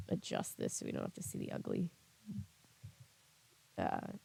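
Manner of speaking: muttered aside, nearly whispered; under breath. Real, not acted. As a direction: whisper it to yourself while fiddling. Muttering while adjusting screen.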